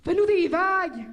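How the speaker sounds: loud, slow shout across water; calling out. Retold or imitated. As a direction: yell to the big boat. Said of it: "j'ai demandé au gros bateau".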